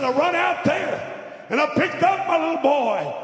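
high, loud, impassioned retelling; anguished outcry. Retold or imitated. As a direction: cry it out as the horrified father, drawn out. Father relives running to hit son.

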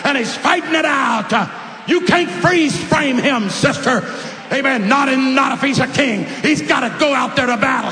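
loud, rising preaching cadence; preacher exhorting the sweet ladies, loud; full-force preaching. Real, not acted. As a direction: drive it home to the congregation.

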